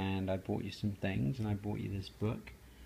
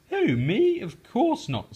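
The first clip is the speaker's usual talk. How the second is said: much higher and louder squeak; squeaky Froggy denial; 11 semitones higher, 8 dB louder than usual. Acted. Froggy insisting to mother he's not nervous, high squeak.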